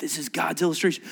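ordinary talk

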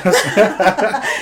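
high amused laugh. Real, not acted. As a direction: chuckle at own joke. Host laughs.

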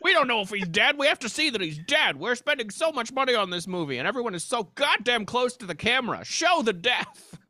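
loud, high, brash studio exec; Hollywood producer voice. Acted. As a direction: demand it like a greedy movie producer. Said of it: "my Hollywood producer voice".

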